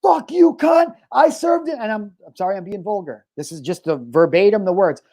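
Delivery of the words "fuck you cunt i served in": high-pitched reenacted angry yell; imitated screaming. Retelling a guy yelling at the lady, screaming at her. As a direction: act out a man yelling abuse, pitched way up. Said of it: "Screaming at her".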